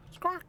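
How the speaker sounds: very high squawk; mock bird squawk; crow squawk. Imitating a crow.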